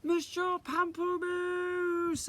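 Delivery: long drawn-out high shout. Acted. Isadora shouting for her teacher, long shout. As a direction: bellow his name across the room. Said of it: "I shouted".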